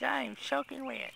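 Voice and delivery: the wet cat speaking in a tiny high voice; voicing the cat. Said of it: "He says".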